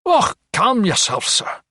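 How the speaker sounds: high-pitched indignant rebuke. A narrator's reading. Physician answers doctor's sudden outburst, indignant. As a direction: rebuke the visitor, pitched well up. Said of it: "He seemed indignant rather than frightened".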